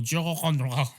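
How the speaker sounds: drawn-out mock French pronunciation; French accent. Shown as a demonstration. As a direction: fake the French with a throaty r. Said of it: "You can say anything in a French accent".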